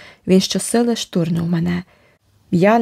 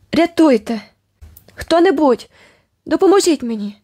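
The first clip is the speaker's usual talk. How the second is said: desperate cries for help; 8 semitones higher than usual. A narrator's reading. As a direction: cry out for rescue into the dark. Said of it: "Я кричала". (високий гучний крик про допомогу)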